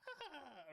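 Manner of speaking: high short eagle shriek; mock eagle cry. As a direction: squawk it like a bird of prey. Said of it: "whatever fucking eagle noise".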